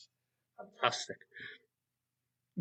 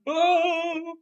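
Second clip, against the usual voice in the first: high, loud playful scream; mock frightened cry; 8 dB louder than usual. Storyteller when the crocodile can't be found, mock scream. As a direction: cry out in pretend panic.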